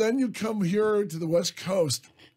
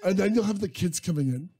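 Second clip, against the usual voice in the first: ordinary delivery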